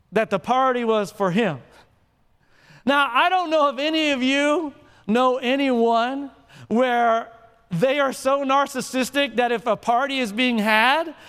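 ordinary delivery

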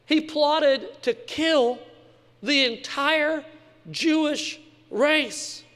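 slow, high, each word weighed; slow grave emphasis. Shown as a demonstration. Preacher telling congregation of Haman's plot, slow and grave. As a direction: lay out the horror slowly. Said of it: "emphasising the genocide plot".

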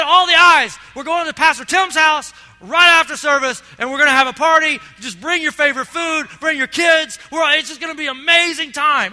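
loud, high, party-announcer energy; excited invitation. Retold or imitated. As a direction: hype up the crowd for a party. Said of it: "If I said".

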